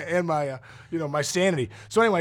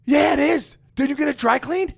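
alarmed realization; 10 semitones higher, 4 dB louder than usual. Retold or imitated. Realizes it's the cat jacket.

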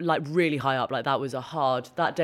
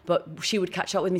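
ordinary delivery

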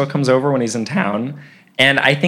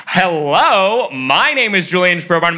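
put-on higher, eager teenage greeting; confident introduction; 6 semitones higher, 4 dB louder than usual. Thirteen-year-old greets his idol at the door, eager and confident.

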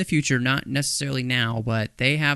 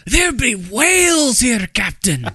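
high, loud mock Scottish brogue, slowed down; Scotty voice; 11 semitones higher, 8 dB louder, slower than usual. Podcaster imagining Scotty reporting to the captain, mock Scottish brogue. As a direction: ham up a Scottish engineer's drawl. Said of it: "imagining Scotty being able to say".

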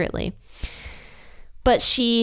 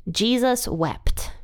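slow solemn reading; 3 semitones higher, much slower than usual. Real, not acted. Reading the famous short verse.

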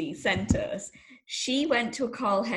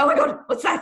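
mock panic; 6 semitones higher, 6 dB louder, faster than usual. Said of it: "So I'm like".

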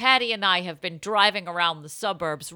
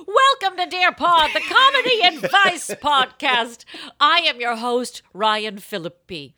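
louder, higher announcer welcome; hammed-up podcast welcome; host intro; 6 semitones higher, 7 dB louder than usual. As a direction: open the show like a radio host.

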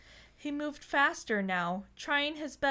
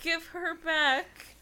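desperate plea; 7 semitones higher, slower than usual. Acted. Gerard repeats his plea.

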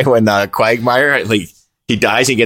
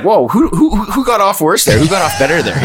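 mock amazed; 7 semitones higher than usual. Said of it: "it's like".

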